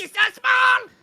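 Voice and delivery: very high, loud squeal. Pretend. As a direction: squeal with delight. Cooing over tiny avatar.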